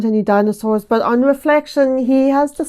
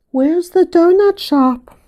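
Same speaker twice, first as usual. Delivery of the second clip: put-on high, slow, innocent little-kid voice; slow toddler voice; 7 semitones higher, slower than usual. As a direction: repeat it slowly and sweetly like a three-year-old. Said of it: "in his little three-year-old voice".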